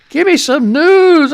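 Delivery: loud, slow demand; calling out for something to read; impatient call. Real, not acted. To the chat, a loud slow call for news.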